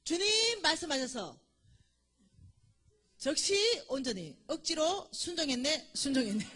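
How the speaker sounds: loud reenacted cry to God. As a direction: shout the prayer up to the Lord, ending in a laugh. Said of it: "다시 한번 외쳤습니다".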